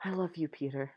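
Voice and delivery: soft, hushed confession; breathless whispered love confession. Acted. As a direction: let it slip out on barely any breath. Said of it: "comes out almost embarrassingly breathless".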